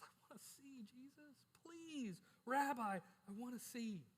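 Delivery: pleading. Acted. Beggar pleading with Jesus.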